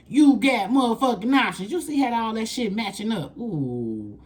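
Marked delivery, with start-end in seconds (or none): 0.1-1.7 hyped-up emphatic repeat; louder emphatic repeat. Shown as a demonstration.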